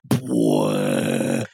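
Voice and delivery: very low, slow Bwah blast; deep laser blast sound. Retold or imitated. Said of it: "The Cyclops I-beam, which is".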